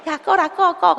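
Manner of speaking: high kiddie voice, playfully mimicked; imitated children. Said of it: "이러면서". (아이들 흉내 낸 높은 목소리)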